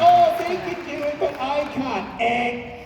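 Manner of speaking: high, whiny self-pity, mocking it; mock whiny excuse. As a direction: mimic someone making excuses, then slow down on "but I can't".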